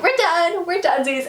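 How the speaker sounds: high, flippant acted quote; playful breakup announcement; mock dismissive. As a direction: pitch it up, then let it drop. Alex voiced, breaking it off.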